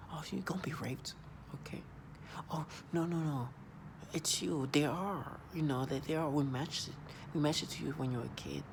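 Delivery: hushed mocking imitation of tormentors; mocking whisper. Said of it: "They speak like that".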